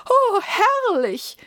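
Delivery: high, slow, loud drawn-out rapture; rapturous court preacher. Acted. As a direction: swoon over the sound like a pompous cleric. Court preacher admires frog croak.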